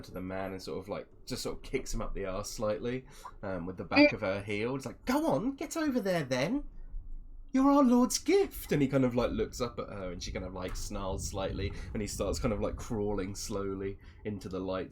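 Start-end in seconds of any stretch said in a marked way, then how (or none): 5.0-8.7 high, loud goading voice; taunting villainess. Acted. Masked woman kicks the man toward the party, high and goading. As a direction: shove him along with a high, mocking order. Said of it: "she kind of, um, leans down".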